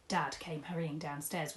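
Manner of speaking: ordinary delivery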